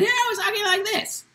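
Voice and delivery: very high, squeaky put-on voice; weird little voice. Shown as a demonstration. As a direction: demo a cartoonishly high changing voice. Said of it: "my voice started to have that weird little sound".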